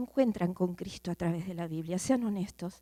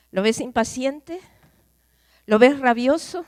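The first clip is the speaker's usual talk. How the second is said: rising loud questions; 3 semitones higher, 10 dB louder than usual. Acted. Preacher building his questions.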